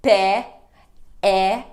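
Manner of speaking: pé and é said loud with an open É; open É sound. Shown as a demonstration. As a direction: belt out the open vowel in pé and é. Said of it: "nós temos o É". (É aberto, forte e claro)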